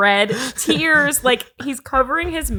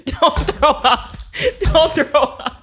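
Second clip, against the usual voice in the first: laughing; 3 semitones higher, much slower than usual. Real, not acted. Laughing at a friend gagging.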